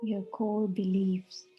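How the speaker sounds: measured meditation voice with long, spacious pauses; guided meditation. Real, not acted. Meditation leader guiding listeners on an inward journey, unhurried guiding voice. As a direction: guide them inward, phrase by phrase, leaving silence between.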